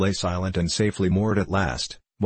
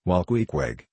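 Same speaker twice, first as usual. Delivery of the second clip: usual speaking voice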